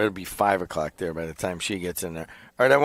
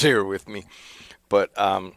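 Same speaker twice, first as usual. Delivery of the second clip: usual speaking voice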